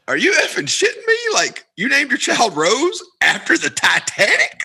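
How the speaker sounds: high-pitched incredulous outburst, imitated; busting out. Man told a child is named after Titanic, imitated busting out. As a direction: bust out in mock disbelief, voice shooting up. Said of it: "he just starts busting out like".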